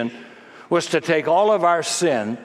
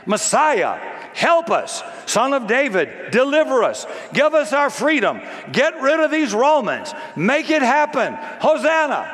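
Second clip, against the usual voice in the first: high-pitched cries for rescue, phrase by phrase; urgent crowd plea; 10 semitones higher than usual. Acted. Preacher voicing the crowd begging the Messiah for freedom, urgent plea. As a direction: plead urgently, pitched well up. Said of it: "So Hosanna meant".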